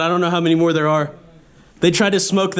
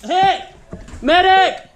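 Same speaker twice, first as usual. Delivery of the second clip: high urgent shout for a medic; man down shout; 4 semitones higher, 3 dB quieter, much slower than usual. Real, not acted. Teammate hit, calling medic.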